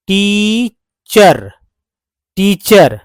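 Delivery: Teacher stretched very slowly, then repeated; slow model word. Shown as a demonstration. Teacher drills teacher slowly for the class. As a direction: drag out teacher, then say it again. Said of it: "एक word बन गया".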